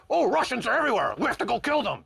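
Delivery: a hint of a jumpy war hawk; mock paranoid general. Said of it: "And they're like".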